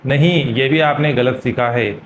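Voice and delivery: usual speaking voice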